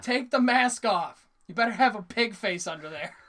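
loud, high cartoon outburst; cartoon quote. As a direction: bellow it like an indignant cartoon character. Imitating Family Guy cartoon scene.

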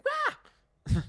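very high, loud startled cry; mock fright squeal; startled yelp. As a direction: jump out of your skin. Eugene startled.

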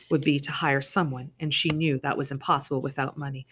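ordinary delivery

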